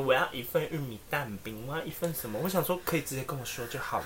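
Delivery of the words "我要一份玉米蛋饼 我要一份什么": soft cutesy voice. Retold or imitated. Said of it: "就用软萌音说".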